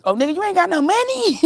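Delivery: mock scornful shout. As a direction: jeer at the broke date. Mocking a broke date.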